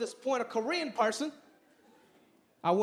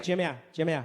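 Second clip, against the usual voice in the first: mom voice; 8 semitones lower than usual. Retold or imitated. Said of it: "She was like".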